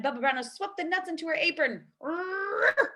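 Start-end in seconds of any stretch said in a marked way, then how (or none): 2.0-2.9 high loud growl; bear roar. Acted. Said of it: "Old Bear roared".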